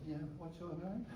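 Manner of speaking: a tough man suddenly sheepish and soft; wimpy wuss voice. Retold or imitated. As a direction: play the bully gone timid. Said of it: "he was a wimpy wuss".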